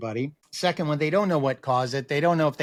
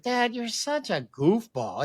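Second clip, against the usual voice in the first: higher, drawn-out voice; teasing daughter; 7 semitones higher, slower than usual. Retold or imitated. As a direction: imitate a daughter slowly teasing her dad. Daughter's dismissive line, acted.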